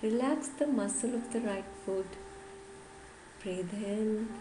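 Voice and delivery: slow, measured yoga nidra guidance; guided meditation. Acted. Yoga teacher guiding listeners to relax the whole body, guided meditation. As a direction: lead them gently through the body, phrase by phrase with long pauses.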